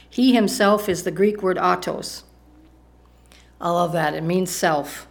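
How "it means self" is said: ordinary delivery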